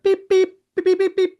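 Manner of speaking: very high beeps mimicking a bleep tone; be the TV censor bleep; censor bleep imitation. Bleeping out expletives for the podcast listeners, high mock beeps. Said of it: "There should be, like".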